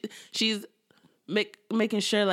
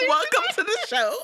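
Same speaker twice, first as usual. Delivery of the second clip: mock welcome; 3 dB louder than usual. Welcoming the new Grace.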